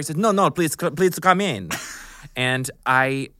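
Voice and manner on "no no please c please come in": put-on high, loud accented director voice; audition director. Director waving an embarrassed actress in, high and insistent. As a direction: imitate an insistent foreign casting director.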